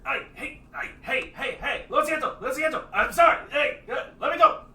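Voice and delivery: high, loud, frantic begging; high panicked captive begging; panicked pleading. Acted. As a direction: beg to be let go, panicking. Captive NPC pleading to be released.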